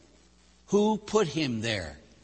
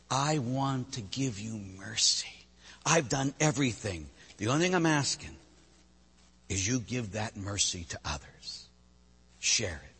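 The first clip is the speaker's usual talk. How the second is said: gentle plea; 4 semitones lower, 8 dB quieter than usual. Acted. God the Father speaking tenderly.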